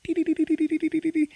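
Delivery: high beeping grenade timer, a steady series of "dee". Retold or imitated. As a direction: imitate a grenade's countdown beeps, way up high. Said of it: "you hear the grenade going".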